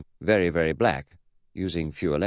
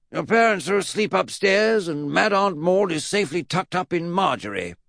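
high, eccentric reassurance; pitched way up, forceful and odd; Mad Uncle Jack; 11 semitones higher, 3 dB louder than usual. Acted. Great-uncle reassures the boy about his parents, high voice. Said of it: "Mad Uncle Jack reassured him".

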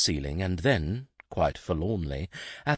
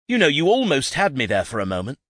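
louder, higher, fast exasperated yell; muffled scream; 8 semitones higher, 7 dB louder, faster than usual. A narrator's reading. Son screams into his cloak at his father, loud and fast. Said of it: "and screamed".

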